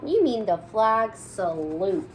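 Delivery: slow, emphatic correction. Acted. Kids correcting their teacher, slow and emphatic. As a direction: lay it out slowly.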